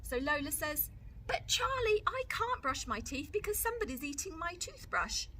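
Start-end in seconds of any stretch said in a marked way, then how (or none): 1.3-5.3 high, cheeky little sister; a small girl insisting, higher; Lola's little-girl voice. Acted. Lola voiced by reader.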